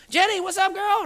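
very high, sassy hello; excited greeting. Retold or imitated. Patient greeting his favourite nurse, excited.

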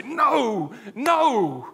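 two stricken, anguished cries of no; a heartbroken no, no at terrible news; pained no. Shown as a demonstration. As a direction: react as if hearing someone you love has died. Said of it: "What do we say? We say".

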